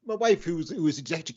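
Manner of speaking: ordinary talk, nothing marked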